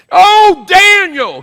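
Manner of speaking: full-voiced high holler; yelling king. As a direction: yell it out, stretching the name. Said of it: "he starts yelling".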